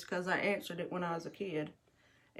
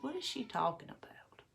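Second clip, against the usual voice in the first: a hushed, wondering aside; whispered thought; 4 semitones higher, 6 dB quieter than usual. Retold or imitated. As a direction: whisper it to yourself, puzzled. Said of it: "And I was like".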